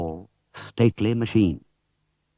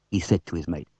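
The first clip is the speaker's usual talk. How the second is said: ordinary delivery, nothing marked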